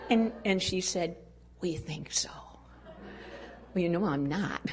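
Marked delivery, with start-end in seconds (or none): 1.6-2.3 soft secretive whisper; whispered reply. Retold or imitated. Mother whispering back to her curious daughter. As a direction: let it out as a hushed confidence. Mother answers child quietly.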